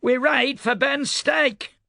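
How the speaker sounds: put-on old woman's northern Fatrastan twang, pitched high; northern Fatrastan drawl of an old rider; northern Fatrastan accent, high and weathered. A narrator's reading. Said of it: "her northern Fatrastan twang".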